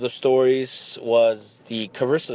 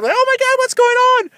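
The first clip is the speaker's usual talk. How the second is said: much higher, louder panicked shriek; shrill wake-up panic, much higher; panicked girlfriend; 6 dB louder than usual. Retold or imitated. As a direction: squeal it like a startled girlfriend. Girlfriend woken, voiced in panic.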